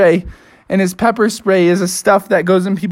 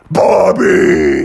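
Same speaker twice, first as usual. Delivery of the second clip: very low, menacing creature voice; monster tree; 3 dB louder than usual. Acted. Living tree calls to Bubby, menacing. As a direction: draw the name out like a monster. Tree comes to life, speaks.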